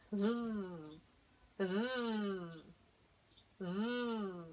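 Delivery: "z" con la lengua entre los dientes, como la z de España. Shown as a demonstration. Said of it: "pero entre dientes". (sonido "z" entre dientes)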